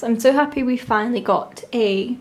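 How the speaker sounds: ordinary delivery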